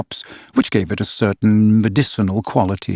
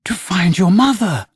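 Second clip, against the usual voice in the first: higher, gentle coaxing; 7 semitones higher than usual. A narrator's reading. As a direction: lure him gently with the promise of a mother. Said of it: "she coaxed".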